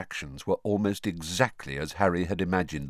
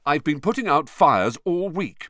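important; 10 semitones higher, 5 dB louder than usual. Acted. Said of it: "Percy told them importantly".

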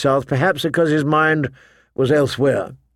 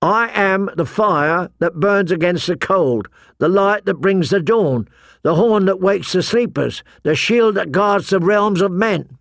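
higher-pitched, sustained oath chant; reciting; 6 semitones higher than usual. A narrator's reading. Pip spurring closer to John, reciting. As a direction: recite it as a rhythmic vow.

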